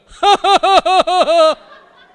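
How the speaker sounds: put-on loud, high, forced toddler laugh; imitated fake laugh. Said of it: "he will fake a laugh".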